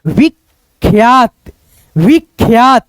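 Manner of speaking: विख्यात slowly in two beats, विख्-यात; joined kh-y. Shown as a demonstration. Teacher showing students the reading, विख्यात slow as विख्-यात. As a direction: close विख् and then glide into यात in विख्यात. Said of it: "इसको क्या पढ़ेंगे".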